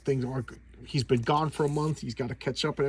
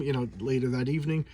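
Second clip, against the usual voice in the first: ordinary talk, nothing marked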